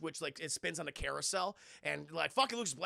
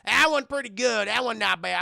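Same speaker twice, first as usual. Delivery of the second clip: loud food verdicts; 3 semitones higher, 11 dB louder than usual. Retold or imitated. Chad tasting sushi approvingly.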